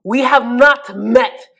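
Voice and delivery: badly faked Egyptian accent, stern and deliberate. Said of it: "I actually cannot do an Egyptian accent".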